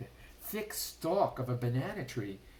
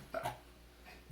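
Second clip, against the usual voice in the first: menacing breathy shark chuckle; low evil chuckle; 8 dB quieter than usual. A narrator's reading. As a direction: chuckle at prey. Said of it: "The shark chuckled".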